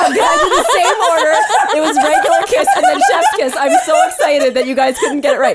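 gleeful and laughing. Real, not acted. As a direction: giggle through it, delighted. Gleeful reveal that both guessed wrong.

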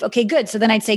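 ordinary delivery

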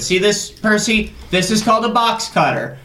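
high, fast mock threat; menacing threat. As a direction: threaten him with a hammy high voice. Threatening intruder with box cutter.

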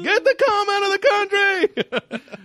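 high, loud, slow hammed-up accent; mock Chinese accent. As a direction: shriek it in a silly accent. Mock accent riff continues.

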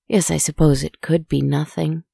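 ordinary delivery, nothing marked

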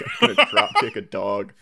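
laughing through a tough-guy brag; mock alpha male boast. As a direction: puff up like a macho showman, then crack up. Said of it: "alpha male mentality".

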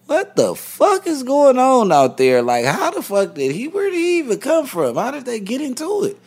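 retold outburst after hearing how his friend was killed; stunned disbelief. Retelling learning how friend died.

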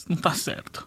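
mock stricken whisper. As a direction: breathe it out, shocked. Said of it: "falou assim".

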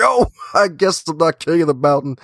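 high-pitched comic realization; mock letdown. Acting out the humbled climber.

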